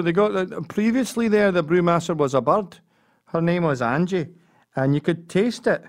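a Glasgow man sharing beer gossip; Glaswegian voice. Retold or imitated.

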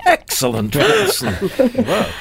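plummy, clipped Holmes imitation; posh English accent. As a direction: echo the detective in a posh voice. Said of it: "Very posh".

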